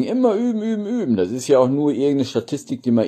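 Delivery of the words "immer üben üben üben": high, eager coaxing; encouraging. Real, not acted. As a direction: cheer them on to practise. Ermuntert die Zuschauer. (hoch und ermunternd)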